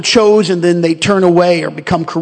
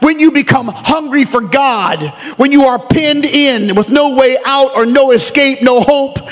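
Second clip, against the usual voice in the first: fervent preaching; 5 semitones higher than usual. Acted. Preacher building to full force.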